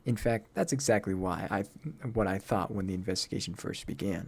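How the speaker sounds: low, restrained deductive voice; stern, aloof game character. Acted. Low figure voice in game dialogue.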